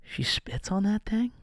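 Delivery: breathless scandalized whisper. Retold or imitated. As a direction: whisper it like a shocked kid. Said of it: "you're like".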